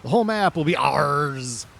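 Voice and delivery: growled pirate boast. Shown as a demonstration. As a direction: say it like a pirate claiming treasure. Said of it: "I sound like a pirate".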